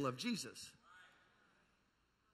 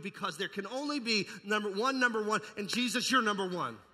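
loud, higher proclamation; shouted declaration; 6 semitones higher, 10 dB louder than usual. Acted. Preacher shouting that Jesus is number one. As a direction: proclaim it like a rally cry.

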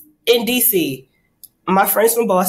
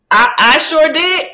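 usual speaking voice

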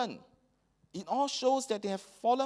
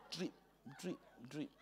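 dripping sound effect; 3 semitones lower, 16 dB quieter than usual. Retold or imitated. Imitating sweat dripping.

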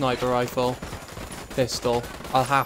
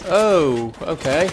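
surprised; 4 semitones higher, 7 dB louder, much slower than usual. Real, not acted. Sudden surprise mid firefight.

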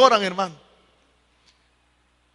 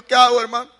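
raised, mildly wailing voice; imitated tearful plea; 3 semitones higher, 3 dB louder than usual. Crying church sister asks her pastor what to do, mock tearful. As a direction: lightly imitate a woman at a loss. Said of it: "la hermanita llorando me dijo".

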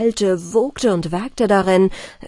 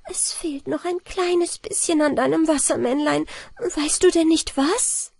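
sad; 8 semitones higher than usual. A narrator's reading. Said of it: "rief sie traurig".

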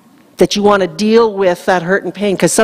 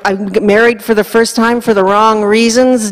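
ordinary talk

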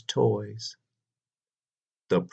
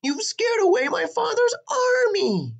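very high, indignant wail; princess wailing blame; faster than usual. A narrator's reading. Princess blaming the dragon for the army, high wail. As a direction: accuse him in a high princess voice. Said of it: "said the princess".

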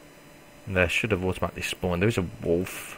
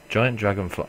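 normal talking voice

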